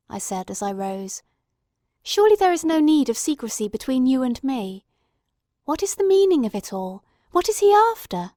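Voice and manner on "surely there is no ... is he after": rising, louder urgent questions; urgent pleading. Acted. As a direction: press him for an answer, voice climbing. Watson presses Holmes urgently.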